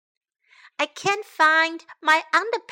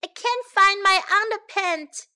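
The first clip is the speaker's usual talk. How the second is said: high, raised complaint; higher voice of acted helplessness; calling out; 6 semitones higher, 4 dB louder than usual. A narrator's reading. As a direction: call out for assistance. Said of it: "called Sam".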